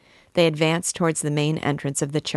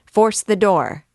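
higher, much louder order; shouted command; 5 semitones higher, 7 dB louder than usual. A narrator's reading. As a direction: bark it to get them moving. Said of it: "shouted Toifu".

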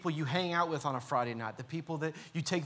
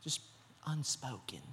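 much softer, trailing mock Southern accent; 4 semitones lower, 10 dB quieter than usual. Churchgoer hiding struggles, soft mock Southern accent. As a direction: breathe out "unspoken" in a put-on Southern accent. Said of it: "they always talk with a real Southern accent".

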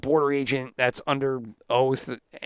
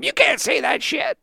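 imitating a congressman screaming back, mildly; mock yell; 10 semitones higher than usual. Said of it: "screaming back at him".

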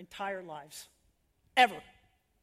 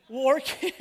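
laughing; 6 dB louder than usual. Real, not acted. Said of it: "I just realized how that sounded".

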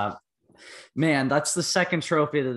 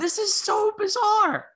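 high, slow amazed exclaim; incredulous; 3 dB louder, slower than usual. Real, not acted. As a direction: stretch it out in disbelief. Amazed by the scandal.

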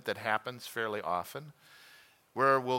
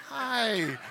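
cute pillow hi; 10 semitones higher, 4 dB louder than usual. Retold or imitated. Couple on pillow, cute greeting.

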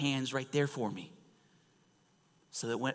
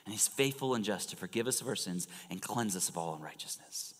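ordinary talk

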